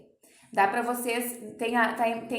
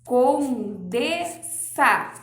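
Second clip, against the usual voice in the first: "condensa" spoken very slowly, each syllable opened; slow, exaggerated "condensa" for the class; syllables drawn out; 5 dB louder, much slower than usual. Shown as a demonstration. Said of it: "como quando a gente abre a boca pra falar a palavra". ("condensa" bem devagar, sílaba por sílaba)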